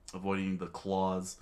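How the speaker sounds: ordinary delivery, nothing marked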